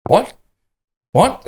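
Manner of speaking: high, rising stunned disbelief; baffled what. Retold or imitated. Clueless groom hearing the news, stunned. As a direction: play him utterly baffled.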